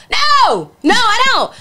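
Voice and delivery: loud, high retold refusal; emphatic no. Refusing the late-night food invite, loud and high. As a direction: shout the no at his invitation.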